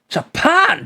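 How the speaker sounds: high, startled Scottish "Japan?". Acted. Governor hears the visitor is Japanese, startled Scottish. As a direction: jolt up on "Japan?" in Scottish accent. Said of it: "how well can you do Scottish?".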